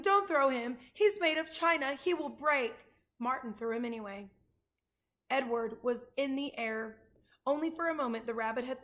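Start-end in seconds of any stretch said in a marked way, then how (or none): 0.0-2.7 urgent, raised, loud pleading; pleading cry. A narrator's reading. Abilene begs boys not to throw her rabbit, crying out. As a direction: plead loudly, easing slightly at the end. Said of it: "cried Abilene".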